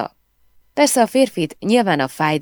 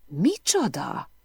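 stunned disbelief; 5 semitones higher, 4 dB quieter, slower than usual. A narrator's reading. Emily stunned by death news.

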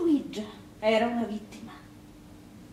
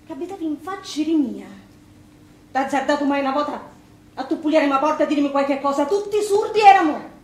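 loud, bitter shout rising higher; angry accusation; 3 semitones higher, 8 dB louder than usual. Acted. Wife accuses the neighbour who never helped, furious shout. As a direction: hurl the blame at the deaf neighbours.

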